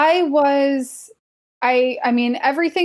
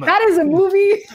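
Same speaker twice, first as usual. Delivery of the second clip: amused scoff; 8 semitones higher than usual. Real, not acted. Rejecting Marvel as film.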